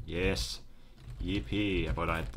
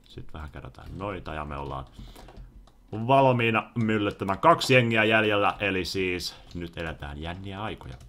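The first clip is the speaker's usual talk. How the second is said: ordinary talk, nothing marked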